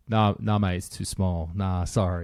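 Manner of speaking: low, quiet, dismissive mock refusal; gruff rental guy. Mimicking the rental guy turning him down, low and dismissive. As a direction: drop low and wave him off, lightly mocking.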